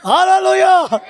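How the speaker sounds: loud hallelujah. Real, not acted. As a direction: shout it back again to the church. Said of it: "గట్టిగా హల్లెలూయా చెప్పండి". (బిగ్గరగా హల్లెలూయా)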